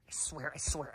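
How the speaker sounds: hushed, voiceless whisper; soft, urgent repeated whisper. Pretend. Frantic repeated swearing after outburst.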